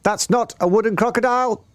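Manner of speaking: very high singsong warning; Roly-Poly Bird. A narrator's reading. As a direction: chirp the alarm like a frantic bird. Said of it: "sang the roly-poly bird".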